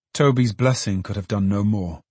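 ordinary delivery, nothing marked

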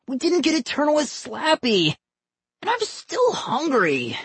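put-on very high whiny kid voice; whining. A narrator's reading. Boy complains to his dad about leaving, whining. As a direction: drag out the complaint in a high whine. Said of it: "Johnny whined".

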